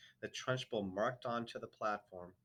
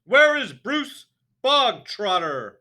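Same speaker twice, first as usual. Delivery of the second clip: slow, high, very loud shout; barking demand; 13 semitones higher, 19 dB louder, slower than usual. A narrator's reading. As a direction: roar it at the whole school. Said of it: "the Trunchbull barked".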